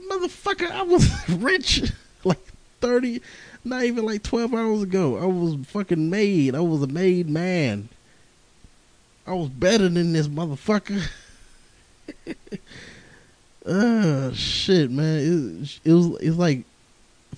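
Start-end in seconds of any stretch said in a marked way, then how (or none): 0.1-11.0 much higher, put-on voice bragging about being rich; mock boastful rant. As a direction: squeal it like a cocky big shot. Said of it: "I'm like".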